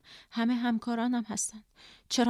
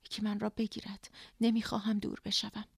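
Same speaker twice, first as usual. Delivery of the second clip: ordinary delivery, nothing marked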